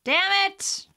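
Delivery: high, loud burst of frustration; frustrated outburst. Real, not acted. Playing trivia alone, she curses her wrong answer loudly.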